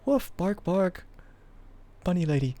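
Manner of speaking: high playful barking words; a goofy yap; imitating Stux barking. Retelling how Stux says it, high barking. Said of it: "It's just like".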